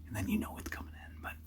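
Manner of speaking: hushed whisper so game can't hear. Real, not acted. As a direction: keep it to a whisper in the blind. Hunter whispering in blind.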